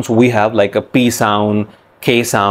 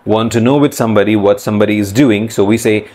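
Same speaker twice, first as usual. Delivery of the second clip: ordinary delivery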